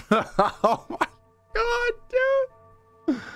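high laugh breaking into yell; real giddy laughing cry; laughing shriek. Real, not acted. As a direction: laugh out in disbelief. Intense game moment.

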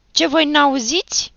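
high, loud angry challenge; angry growled demand. A narrator's reading. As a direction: bark it at boys who ignore you. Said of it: "mărâie Achim".

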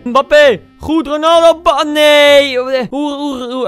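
loud, high commentary on an attack; high-pitched outburst turning to dismay; excited shouting. Real, not acted. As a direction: shout the names, then cry out. Attack building, then missed.

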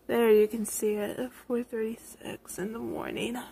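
ordinary talk, nothing marked

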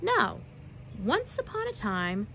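ordinary delivery

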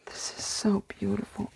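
soft, hushed whisper of wonder; awed whisper. Real, not acted. Opening a beautiful book for viewers, awed whisper. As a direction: breathe it out in quiet awe.

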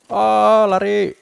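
gleeful run-for-it cheer; excited run. Real, not acted. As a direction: urge the party to flee. Fleeing the guard, cheering.

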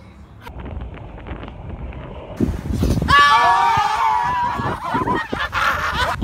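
high, loud burst of laughter. Real, not acted. Magician laughs after reveal.

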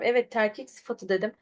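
normal talking voice

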